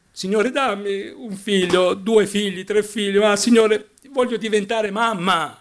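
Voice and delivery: high-pitched, urgent mock plea to God; pleading prayer. A woman begs the Lord for children, high and pleading. Acts out imagined desperate prayer.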